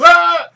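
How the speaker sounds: sudden very high, very loud scream; imitated panicked scream; retold scream. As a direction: let out a shrill yelp of terror. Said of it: "He goes".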